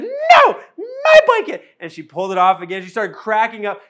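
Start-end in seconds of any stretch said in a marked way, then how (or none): 0.0-1.6 very high, loud, comic squeal of a dad teasing his dog; high-pitched funny dad voice. Retold or imitated. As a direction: go way up into a silly falsetto and hold it. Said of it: "and I said".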